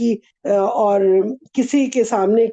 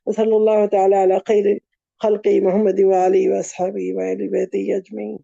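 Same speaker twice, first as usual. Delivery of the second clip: low, even Arabic prayer recitation; closing prayer; 5 semitones lower than usual. Real, not acted. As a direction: murmur a closing supplication, pitched low and fading at the end.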